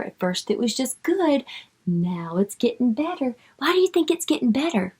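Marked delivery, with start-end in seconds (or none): none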